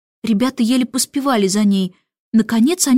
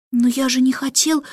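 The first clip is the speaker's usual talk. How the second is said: usual speaking voice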